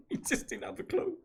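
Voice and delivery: real laughter through the words; amused, breaking into a high laugh. Real, not acted. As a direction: say it while cracking up at your own story. Laughs at his own anecdote.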